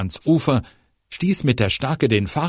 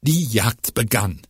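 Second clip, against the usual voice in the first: ordinary delivery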